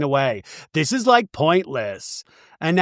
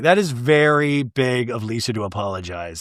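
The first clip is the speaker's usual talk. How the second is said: ordinary talk, nothing marked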